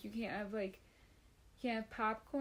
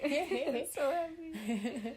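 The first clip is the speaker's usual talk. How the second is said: slow, laughing giddy delight; 5 semitones higher, 5 dB louder, much slower than usual. Real, not acted. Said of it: "I'm smiling giddily".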